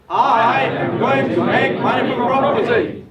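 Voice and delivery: twice as loud. Shown as a demonstration. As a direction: roar the pledge with everything. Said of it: "Twice loud".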